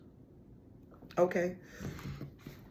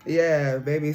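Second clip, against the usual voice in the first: ordinary talk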